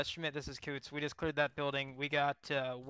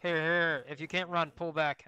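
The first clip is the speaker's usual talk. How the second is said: shouting; 2 semitones higher, 7 dB louder than usual. Real, not acted. Calling teammates in firefight.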